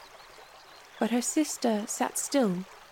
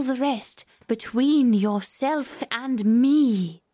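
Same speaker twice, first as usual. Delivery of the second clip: measured, lilting poem reading; verse recitation; 4 semitones higher than usual. Acted. Reading verse aloud to the court, measured recitation. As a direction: recite it with drawn-out, rising emphases.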